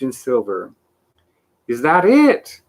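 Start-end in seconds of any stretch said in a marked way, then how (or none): 1.7-2.6 higher, louder eager call; excited shout. A narrator's reading. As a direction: call out at the shining city. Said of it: "shouted Milo".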